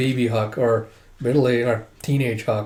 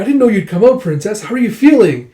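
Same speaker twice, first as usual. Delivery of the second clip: high, loud, eager greeting; excited fussing; 8 semitones higher, 8 dB louder than usual. Acted. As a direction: fuss loudly over the princess. Soldiers fuss over the princess.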